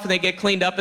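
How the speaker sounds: normal talking voice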